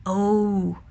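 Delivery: usual speaking voice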